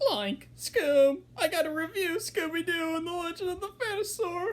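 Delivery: high-pitched cartoon slacker voice; Shaggy. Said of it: "my attempt at a Shaggy impression".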